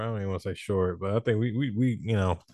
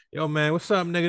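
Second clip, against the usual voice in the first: casual slang greeting; 8 semitones higher than usual. Retold or imitated. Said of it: "go from".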